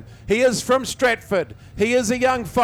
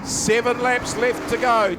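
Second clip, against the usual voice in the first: ordinary talk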